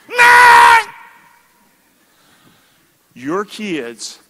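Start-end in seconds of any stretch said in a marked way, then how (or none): 0.1-0.8 high, loud mock tantrum cry; child's scream. Preacher imitating a child refusing to share, loud cry. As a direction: shout it like a toddler refusing to share. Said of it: "they scream".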